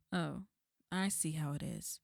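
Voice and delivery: ordinary talk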